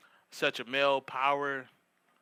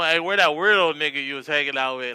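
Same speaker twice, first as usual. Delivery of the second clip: loud, calling across to a friend; 11 dB louder than usual. Retold or imitated. Friend calling out loudly about the weirdo. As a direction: shout the question across the room.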